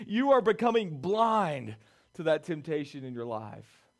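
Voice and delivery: loud, driving altar-call preaching; preaching at full force. Acted. Preacher pleading loudly with a struggling congregation. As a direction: pour it out over the crowd, full voice.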